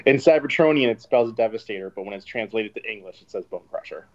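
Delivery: ordinary talk, nothing marked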